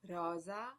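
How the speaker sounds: rosa with the s buzzed as z; voiced s. Shown as a demonstration. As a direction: say rosa slowly with a z sound between the vowels. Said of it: "it is pronounced z".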